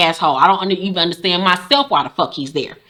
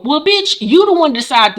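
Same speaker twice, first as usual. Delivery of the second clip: incredulous retort; 7 semitones higher than usual. Real, not acted. Host scoffing at husband's prison excuse, high incredulous rise. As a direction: voice jumps way up on bitch, rising.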